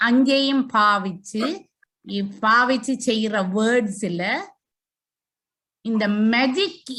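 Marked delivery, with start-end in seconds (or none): none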